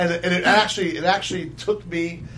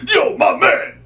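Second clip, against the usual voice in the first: loud, high-pitched call-out; imitating a guy calling out; retold inmate's holler; 10 semitones higher, 6 dB louder, slower than usual. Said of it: "I hear this guy go".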